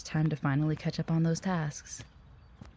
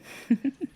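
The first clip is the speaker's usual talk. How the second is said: short, higher-pitched chuckle; light laugh; 10 semitones higher than usual. Acted. Receptionist joking about the boss to a coworker, light laugh. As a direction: chuckle at your own remark.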